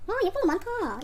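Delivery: bright gasp of delight; light up at the find. Real, not acted. Finding pretty items.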